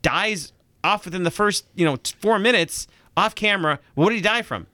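high-pitched, halting string of questions; incredulous confusion. Acted. Host voicing a future viewer losing the main character, incredulous confusion. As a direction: stumble upward through disbelief, playing the confused viewer.

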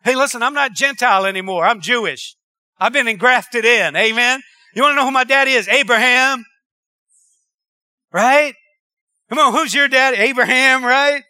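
loud call to the congregation; loud call and response, grinning pride; rousing preaching. Real, not acted. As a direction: fire up the crowd with a proud question.

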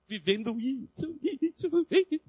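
high-pitched put-on tearful complaint; mock whining. Imitates someone whining about a problem. (lamúria fingida, cada vez mais aguda)

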